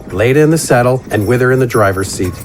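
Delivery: plain reading voice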